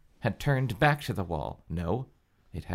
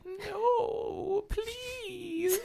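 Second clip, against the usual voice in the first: put-on much higher, soft little-girl plea; tiny childlike voice; 9 dB quieter than usual. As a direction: beg like a frightened small child. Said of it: "Her voice sounded tiny and childlike".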